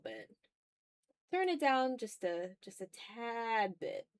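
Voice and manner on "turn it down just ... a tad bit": normal talking voice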